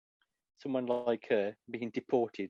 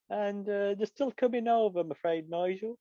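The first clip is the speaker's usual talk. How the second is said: higher-pitched mock weather-lady voice; 7 semitones higher than usual. Weather lady reporting to Nigel Farage, in a high put-on voice. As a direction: put on a high forecast presenter's voice. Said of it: "she could be the weather lady".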